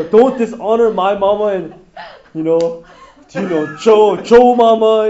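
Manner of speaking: loud, laughing mock of the husband; mocking through laughter. Teacher mocks the husband's defense of his mother, laughing. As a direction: laugh while playing the mama's boy.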